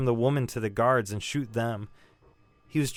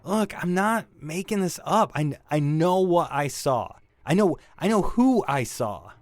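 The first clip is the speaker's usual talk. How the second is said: higher and louder, pleading conviction; desperate insistence; 4 semitones higher, 4 dB louder than usual. Acted. Witness insisting to the detective, desperate and loud. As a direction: insist you know what you saw.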